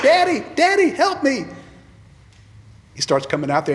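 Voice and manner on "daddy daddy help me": put-on high, loud child's cry for daddy; scared child calling daddy; hollering for help. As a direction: shout like a stuck little girl. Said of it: "she hollered".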